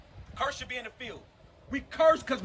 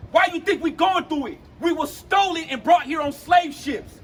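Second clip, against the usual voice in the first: forceful, raised preaching voice; impassioned street preaching; 6 semitones higher, 7 dB louder, slower than usual. Real, not acted. Preacher challenging a brother about suffering, impassioned preaching. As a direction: drive each phrase home with weight.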